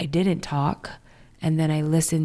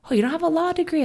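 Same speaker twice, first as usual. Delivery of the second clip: mock shocked advisers; 11 semitones higher, much faster than usual. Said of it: "They're like".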